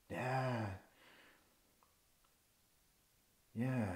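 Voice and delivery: barely audible, subdued yeah; acted timid little boy; small, hurt admission. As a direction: admit it very quietly, as a scared child. Hurt boy admits being scared.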